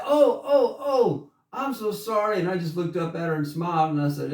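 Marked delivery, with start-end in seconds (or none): 0.0-2.4 high, flustered oh oh oh apology; flustered old lady. Retold or imitated. Lady apologizing after spanking the boy, high and flustered. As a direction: pitch up as the embarrassed older lady. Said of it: "she's like".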